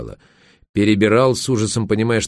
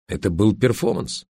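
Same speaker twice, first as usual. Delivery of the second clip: ordinary delivery, nothing marked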